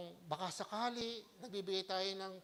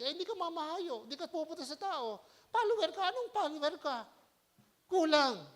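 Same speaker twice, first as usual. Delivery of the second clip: challenging; 6 semitones higher than usual. Acted. Preaching challenge to false follower.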